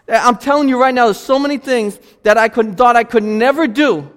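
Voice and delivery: high, loud personal testimony from the pulpit; impassioned preaching. Real, not acted. Preaches at full force.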